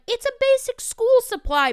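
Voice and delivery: high-pitched exasperated complaint; imitated teacher frustration; frustrated. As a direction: protest in exasperation, pitched well up. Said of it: "we'd be frustrated".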